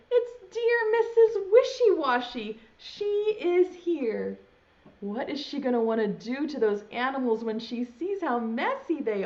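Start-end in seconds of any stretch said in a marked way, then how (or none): 0.1-4.4 high, loud cheer of relief; animals' overjoyed high cheer. Acted. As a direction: cry it out like rescued animals spotting their friend. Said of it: "they quack and they cheer".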